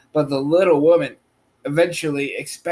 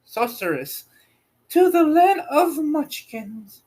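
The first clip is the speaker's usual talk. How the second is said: put-on high, lilting old woman's voice; little old woman; 12 semitones higher than usual. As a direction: voice her as a tiny kindly old woman.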